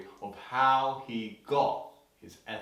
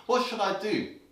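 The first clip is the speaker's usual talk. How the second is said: lightly voiced boy, higher pitch; little boy's question; 8 semitones higher than usual. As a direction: ask mum eagerly as a little boy. New boy asks his mother.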